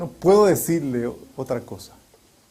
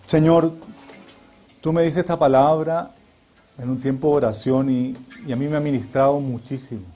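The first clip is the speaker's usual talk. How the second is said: prayer; 2 semitones lower than usual. Real, not acted. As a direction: pray aloud, heartfelt and measured. Prayer after "Oremos.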